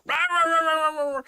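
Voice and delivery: high loud mock barking, rah rah rah; dog barking. Owner imitating his collie at the toll, loud yapping barks. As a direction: yap it out like a frantic dog. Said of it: "He was like".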